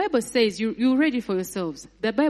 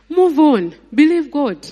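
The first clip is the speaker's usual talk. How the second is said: higher and louder, preaching hard; forceful exhortation; 5 semitones higher, 8 dB louder than usual. Real, not acted. Preacher urging the congregation to move on, louder and higher. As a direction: drive the command home.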